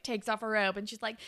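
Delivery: usual speaking voice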